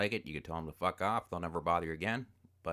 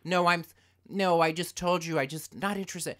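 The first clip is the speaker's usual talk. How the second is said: lightly voiced wife on the phone, higher; imitating wife; 9 semitones higher, 6 dB louder than usual. Wife politely refusing a telemarketer, mock higher voice. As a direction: mimic her fumbling polite refusals. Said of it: "this goes on".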